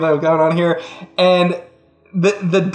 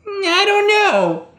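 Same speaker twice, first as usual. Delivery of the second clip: indifferent witch; slower than usual. Acted.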